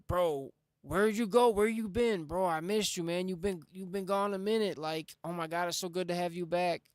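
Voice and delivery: imitating a happy, pleading catch-up with a lost buddy; excited reunion. Dreamer greeting his late friend who visits him, excited reunion.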